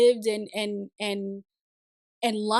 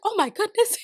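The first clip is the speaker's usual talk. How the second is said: high-pitched amazed reaction; retold astonishment; 11 semitones higher, 4 dB louder than usual. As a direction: act out being caught off guard. Retells reaction to unexpected accent.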